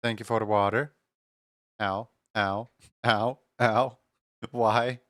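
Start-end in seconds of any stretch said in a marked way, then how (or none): none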